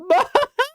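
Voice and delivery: a sudden high laugh; high burst of laughter. Real, not acted. Laughing at the scammed becoming the scammer, high burst of laughter.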